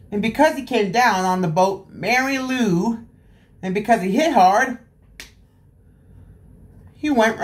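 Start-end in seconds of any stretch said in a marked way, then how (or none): none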